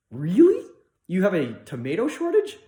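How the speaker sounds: high, loud mock disbelief; incredulous. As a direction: question the excuse as if astonished. Retold reaction to the excuse.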